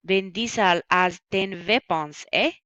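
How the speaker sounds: put-on weird foreign accent, V for W in weapons. Shown as a demonstration. Teacher sharing a memory trick for irregulars, in a weird accent. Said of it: "like, this weird accent".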